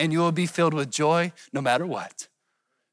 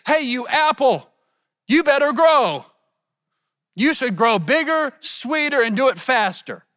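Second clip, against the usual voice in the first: raised, high-pitched pretend scolding; mock yelling; 6 semitones higher, 4 dB louder than usual. Preacher pretending to scold an apple tree, mock yelling. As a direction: order it around in a hammed-up yell. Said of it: "You don't yell at the fruit".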